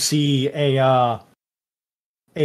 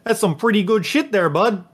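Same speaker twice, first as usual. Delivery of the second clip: higher, quick Canadian buddy voice with a friendly "bud"; Canadian-ish accent; 7 semitones higher, faster than usual. Acted. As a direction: play a chummy Canadian hoser, a bit higher and fast. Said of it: "I can only imagine Ricky with that accent".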